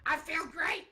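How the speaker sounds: mock guest Roger Stone answering the host, squeaky high; impression of Roger Stone. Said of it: "how do you feel about this new indictment?".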